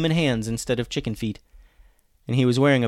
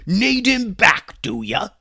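high, loud, drawn-out mockery; taunting pirate captain; mocking pirate echo; 11 semitones higher, 8 dB louder, slower than usual. A narrator's reading. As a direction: throw it back sarcastically as a pirate. Captain mockingly echoes the boy.

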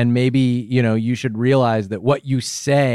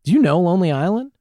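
high, quick, open question; bright inviting question; 7 semitones higher, faster than usual. Shown as a demonstration. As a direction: ask it lightly and invitingly. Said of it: "Just say".

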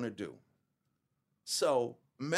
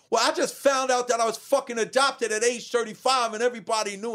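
higher, louder outburst of hurt; a built-up grievance spilling out loud; letting it all out; 10 semitones higher, 4 dB louder than usual. Retold or imitated. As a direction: blurt it all out, finally breaking. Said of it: "let it out".

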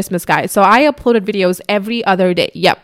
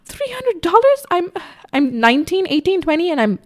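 amazed disbelief; 9 semitones higher than usual. Retold or imitated. Retelling seeing her first payout, amazed. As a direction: gasp it like she can't believe it. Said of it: "I remember that thought in my head".

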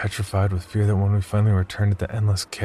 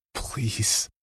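pleading; 3 semitones higher, 5 dB quieter than usual. A narrator's reading. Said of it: "looked at him imploringly".

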